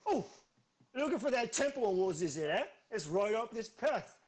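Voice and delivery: put-on brash New Jersey accent, pushed loud and high. Half ogre in a New Jersey accent giving directions to travellers. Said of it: "he suddenly became New Jersey".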